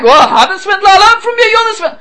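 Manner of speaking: mock-defiant [name] turning it on him; [name] answering back. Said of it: "she answers back".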